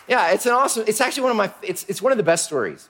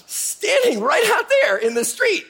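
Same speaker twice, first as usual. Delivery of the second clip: eager servant. Acted. Servant offers the gallows.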